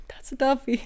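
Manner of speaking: whispered thinking aloud. Real, not acted. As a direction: breathe it out unvoiced. Whispered aside.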